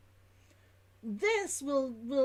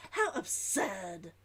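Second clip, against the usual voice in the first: put-on slow, lower Old London verdict; Old London accent; 5 semitones lower, slower than usual. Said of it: "the only way to make that not sound, you know, as silly".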